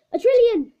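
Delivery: high, loud call. Acted. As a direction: Kenneth Chan calling out his answer to the teacher. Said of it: "called out Kenneth Chan".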